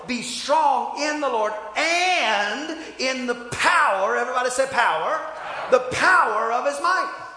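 rising, loud sermon cadence; fiery preacher building to a shout; preaching. Real, not acted. Preaching at full force.

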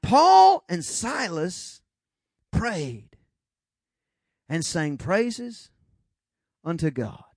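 slow, drawn-out storyteller; slow, hushed Bible storytelling; dramatic storytelling. Shown as a demonstration. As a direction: paint the scene word by word. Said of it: "let's kinda get a picture of this".

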